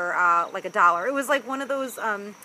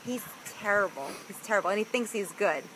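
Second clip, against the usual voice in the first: ordinary talk, nothing marked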